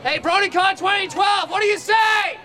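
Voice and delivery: high, shouted crowd hype; big hype-man shout; rallying a crowd. Real, not acted. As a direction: call out to the whole line to get a roar back. Host calls out to the crowd.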